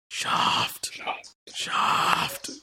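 whispery ghost voice; breathy ghostly whisper. Real, not acted. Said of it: "I sound like a ghost".

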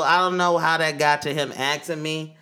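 normal talking voice

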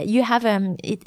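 normal talking voice

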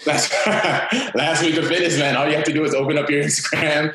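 laughing through the words, pitch up; amused, laughing answer. Real, not acted. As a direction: chuckle as you repeat the question. Laughs at the fitness question.

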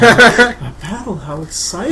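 high, breathless, fascinated maid. Acted. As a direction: gush over the battle like a smitten maid. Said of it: "the maid is fascinated".

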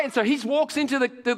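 usual speaking voice

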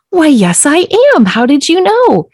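high, playfully flattered answer; mock coy. Answering the stranger who spotted her gift, mock coy. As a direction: play the pleased, knowing empath. Coy reply to man asking empath.

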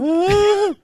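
a high, feeble mock woo; limp woo. Retelling the crowd's feeble woo as a high mock. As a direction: mimic the sad cheer. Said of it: "And it's like".

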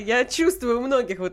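ordinary talk, nothing marked

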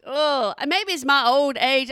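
ordinary talk

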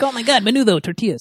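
high-pitched, excited parody of a comic. Retold or imitated. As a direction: send up a hacky stand-up bit.

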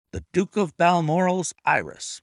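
ordinary delivery

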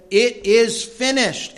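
loud, high, slow cry on It is finished; solemn cry. A narrator's reading. Preacher reading Jesus's last words, loud slow cry.

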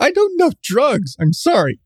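high, sweet feigned innocence; mock innocent voice. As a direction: squeak out the denial. Mock innocent denial again.

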